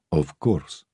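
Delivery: a light hint of a Russian accent on an old man; lightly voiced old Russian countryman; Russian-English accent. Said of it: "the accent forever caught somewhere between rural England and his Russian homeland".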